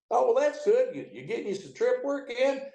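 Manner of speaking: a high, chummy call over the radio; voicing Bill.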